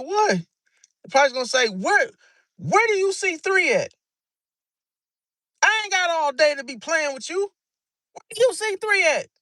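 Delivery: high, sharp impatient questions; irritated parent. Retold or imitated. Pisces parent losing patience with the kid, irritated. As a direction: demand an answer, out of patience.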